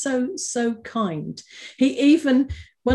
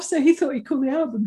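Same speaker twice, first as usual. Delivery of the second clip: usual speaking voice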